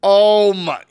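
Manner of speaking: loud, high-pitched astonishment; shocked disbelief. Real, not acted. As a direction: exclaim it high and stretched out, appalled. Stunned reaction to calling animal control.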